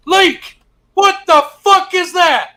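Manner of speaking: high, loud, slow mock yell. Recruit retells a TI screaming at him in the chow line, high mock yell. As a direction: bellow it like a drill instructor, half laughing. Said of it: "my sister flights T I".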